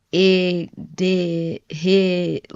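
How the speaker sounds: drawn-out Philly vowels in "It did hit"; imitated slow Philly pronunciation of "It did hit"; Philly accent. Shown as a demonstration. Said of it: "y'all will say, like".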